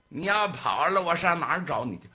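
loud, quick suspicious challenge; gang boss. Acted. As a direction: call his bluff.

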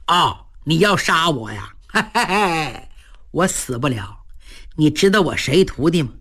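low, chuckling, unbothered; smug chuckle. A narrator's reading. Cheng mocks the general who threatens him, low chuckling. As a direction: laugh off the death threat.

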